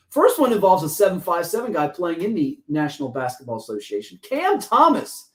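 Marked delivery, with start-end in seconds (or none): 4.2-5.3 high, drawn-out player introduction; announcer-style name reveal. Pretend. Host announces featured player's name.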